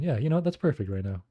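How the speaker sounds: usual speaking voice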